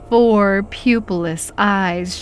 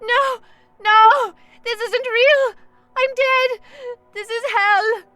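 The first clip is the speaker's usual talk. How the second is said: very high desperate terrified cry; panicked shout. A narrator's reading. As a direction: scream it in denial. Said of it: "she shouted".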